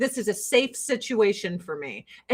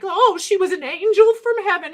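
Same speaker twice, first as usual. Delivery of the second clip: mock adoring; 7 semitones higher, 3 dB louder than usual. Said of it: "and be like".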